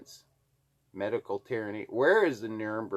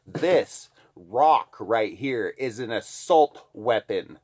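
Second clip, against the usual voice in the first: sarcastic; 7 dB louder, slower than usual. Real, not acted. Sarcastic mock claim.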